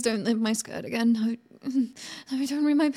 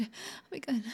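softer whisper of submission; whispered promise; 11 dB quieter than usual. Acted. Woman promising obedience to her captor, whispered. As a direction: barely breathe the promise.

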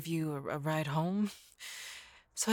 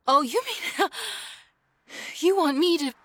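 much higher and louder, laughing with delight; overjoyed acceptance; 9 semitones higher, 4 dB louder than usual. Acted. Girlfriend accepting the listener's proposal, ecstatic. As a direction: squeal your yes.

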